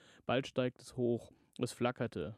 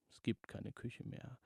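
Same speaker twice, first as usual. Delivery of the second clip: breathy whisper, no voice; 6 dB quieter than usual. Acted. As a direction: whisper it like a secret. Eerie voice in the dark house.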